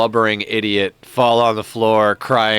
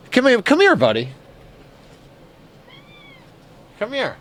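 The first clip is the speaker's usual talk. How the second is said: high sing-song come here; calling a pet. Real, not acted. Player calls the pet over, high sing-song. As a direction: coax the pet over, rising and eager.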